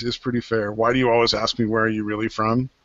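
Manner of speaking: ordinary talk, nothing marked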